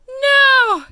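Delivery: sudden startled storybook gasp. Acted. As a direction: blurt it out in a gasp. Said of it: "gasped the rhinoceros".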